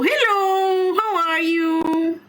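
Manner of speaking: high, drawn-out singsong hello; playful greeting. Pretend. Gamer answering a hello heard in game, playful singsong.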